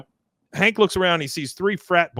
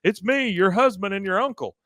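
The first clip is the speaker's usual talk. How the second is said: calling out; 8 semitones higher than usual. Retold or imitated. Hank calling at locked gate.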